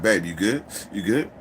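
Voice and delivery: low aside to a partner; imitated sly undertone; covert check-in. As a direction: drop the voice for a secret check. Covert check during loud stalling.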